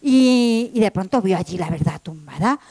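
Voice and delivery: ordinary talk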